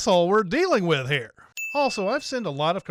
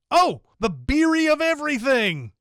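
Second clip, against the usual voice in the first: triumphant pun; 6 semitones higher, 4 dB louder than usual. Real, not acted. Proud of the final pun.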